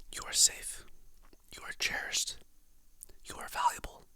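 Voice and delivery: usual speaking voice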